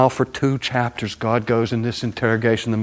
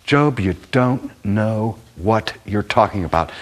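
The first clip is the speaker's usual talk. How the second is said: ordinary talk, nothing marked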